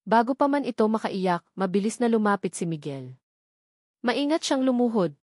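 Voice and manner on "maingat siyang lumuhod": plain reading voice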